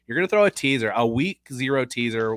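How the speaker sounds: normal talking voice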